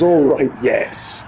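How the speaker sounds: slow, drawn-out, satisfied "Oh, right, yes"; mock complacent. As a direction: take your time, pleased and unbothered.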